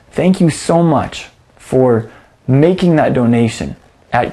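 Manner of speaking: polished sign-off to the audience; scripted donation appeal. Acted. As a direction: read a closing ministry message with deliberate phrasing. Recorded closing donation appeal.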